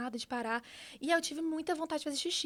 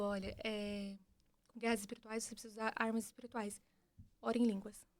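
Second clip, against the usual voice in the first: much softer, gentle; loving voice; 3 semitones lower, 8 dB quieter than usual. Retold or imitated. Voice of God answering, soft and loving. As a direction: speak it softly with love. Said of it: "a voz com muito amor falava".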